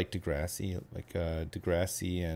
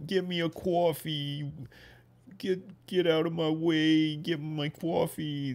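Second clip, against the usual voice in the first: higher, slow pushy New York accent; a demanding New Yorker ordering coffee, New York accent; 8 semitones higher, slower than usual. Shown as a demonstration. Guest keeps showing host the New York accent. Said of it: "the New York accent".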